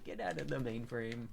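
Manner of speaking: ordinary delivery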